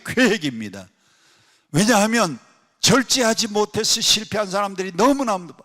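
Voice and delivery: preacher rising to a climax; full-force preaching. Acted. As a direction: preach it with fire to the congregation.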